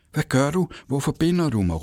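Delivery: put-on a girl calling out in fear, pitched far up; crying out. A narrator's reading. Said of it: "råbte hun, mens hun græd". (højt, bange råb fra en lille pige)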